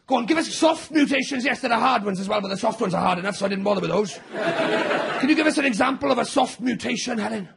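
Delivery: normal talking voice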